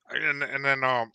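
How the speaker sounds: normal talking voice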